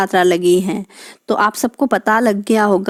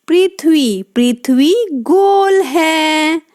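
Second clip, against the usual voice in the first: पृथ्वी गोल है read slowly and higher; 5 semitones higher, slower than usual. Shown as a demonstration. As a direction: read it slowly, like for small children. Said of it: "प में री की मात्रा लगी है". (धीमी, ऊँची आवाज़ में पढ़ना)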